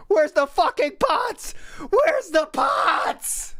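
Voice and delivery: high, drawn-out panicked cry; frantic mock search. Mocking the streamer hunting for pots, high slow wail. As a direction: wail it like he's lost everything.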